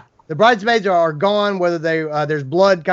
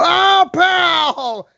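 mock yell; 12 semitones higher, 4 dB louder than usual. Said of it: "and you go".

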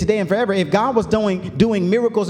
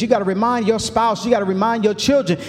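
building preaching; 6 semitones higher than usual. Acted. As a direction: drive it home to the church.

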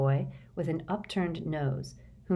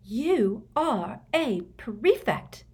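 slow sharp snarl, higher; 6 semitones higher, 3 dB louder, much slower than usual. A narrator's reading. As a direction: snap it out slowly, scolding. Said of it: "snarled Hermione".

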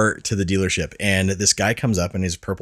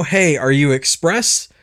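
mock valet, higher and louder; a hammed-up busy valet asking; retelling the valet's questions, a bit pushy; imitating the valet; 7 semitones higher, 5 dB louder than usual. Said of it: "He just wants to know".

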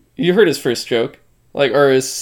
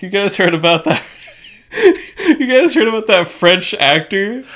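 higher, laughing mock question; imitating the comic's teasing question; comedian bit; 6 semitones higher, 4 dB louder than usual. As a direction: giggle through the setup. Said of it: "he's like".

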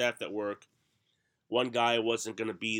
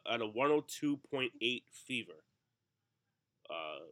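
usual speaking voice

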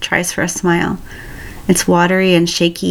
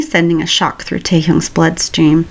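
plain reading voice

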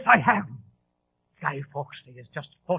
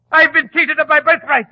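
higher and louder, bitter rage; furious outburst; 9 semitones higher, 12 dB louder than usual. Acted. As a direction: hurl the grievance out. Raging over his stolen throne.

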